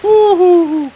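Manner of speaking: high startled cries; startled yelps. Real, not acted. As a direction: yelp at the near miss. Near miss in boss fight.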